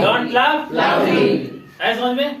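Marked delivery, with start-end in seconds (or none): 0.0-1.3 slow model sentence. Shown as a demonstration. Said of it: "teacher models sentence".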